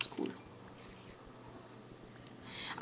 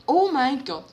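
startled; 4 semitones higher, 8 dB louder than usual. Real, not acted. Startled by noise.

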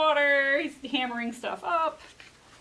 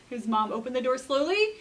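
ordinary delivery

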